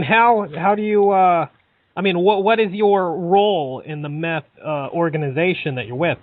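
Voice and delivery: usual speaking voice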